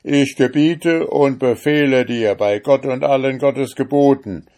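loud, high solemn incantation; mighty conjuring. Acted. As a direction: thunder the binding words at the devil. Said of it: "mit mächtigen Bannworten zu beschwören". (mächtige, laute Beschwörung)

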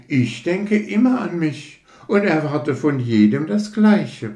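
a lightly voiced conceited rocket, touchy and proud; hint of a fussy aristocrat taking offence; vain rocket. Rocket lectures the fireworks about itself, mildly haughty. Rocket's self-important tirade.

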